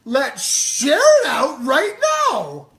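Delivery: high, loud, slow mocking echo of his wife's cheer; mocking imitation. Husband mocking his wife's cheer, high and loud. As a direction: parrot her line back in a squeaky exaggerated voice. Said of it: "Can I tell you what that voice sounded like?".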